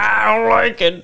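mock blustering club boss; hammed-up manager in protest; up in arms. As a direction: grumble like an indignant business bigwig in his accent. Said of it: "getting really up in arms".

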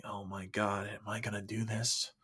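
soft, hushed dread; anxious inner thought. Retold or imitated. Man approaching the Walmart front, quiet dread. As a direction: think it aloud under the breath. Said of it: "slowly walked up to the front thinking".

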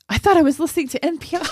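high, fast imitation of surprised listeners; retold surprise. Host imitating friends hearing the show, high-pitched mock surprise. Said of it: "I guess they were like".

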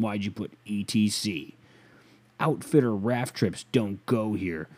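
usual speaking voice

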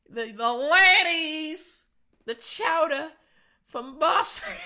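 high, slow Boston voice; Boston accent. Pretend. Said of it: "I can't do that Boston accent".

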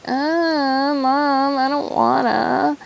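put-on high, whining child voice; whiny little kid. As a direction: play a little kid begging mom to stay in bed. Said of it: "I'm like that little kid".

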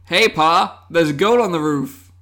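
a loud, lightly hammed-up mystery rural accent; vague put-on accent. Shown as a demonstration. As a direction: belt out Hey, Pa in a loose yokel accent. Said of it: "That was the accent".

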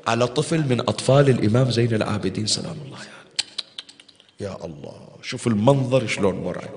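soft, low, grieving; hushed grief. Acted. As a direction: sink the voice in sorrow. Horrified description of child's death.

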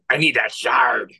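slow growly pirate; an inner pirate grumbling. Acted. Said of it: "Inner pirate, naked pirate voice".

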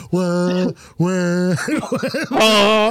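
drawn-out "wah" noises, building in pitch and volume; imitated wailing cry. Mimicking the Sand people, wailing imitation. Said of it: "they're just like".

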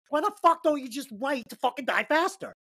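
high-pitched comic exasperation; acted-out baffled complaint; exasperated. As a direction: protest in mock disbelief, voice climbing. Reenacting exasperation with wife's wording.

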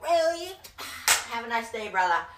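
high, loud disbelief, then a huffy send-off; mock indignation. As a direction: imitate a rebuffed street seller. Rebuffed seller acted out.

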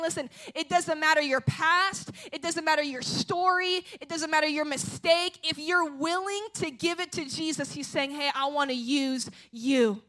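loud, fervent sermon delivery; passionate preaching. Acted. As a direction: preach it with conviction to the room. Preaching at full force.

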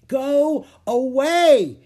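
high, drawn-out angry yell; shouting. A narrator's reading. Girl yelling at a screeching monkey, shouting. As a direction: yell it loud and long. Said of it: "Annie shouted".

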